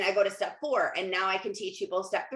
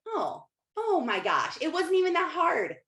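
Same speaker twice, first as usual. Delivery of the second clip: relieved amazement; 8 semitones higher than usual. Acted. Waking up one day after the struggle, relieved amazement. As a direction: voice lifts in acted amazement. Voicing future relieved realization.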